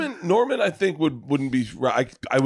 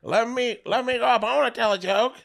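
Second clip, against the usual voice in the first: much higher put-on heckler voice; high-pitched begging to get up there; pretend heckler; 12 semitones higher than usual. Would-be heckler wanting the stage, high put-on plea.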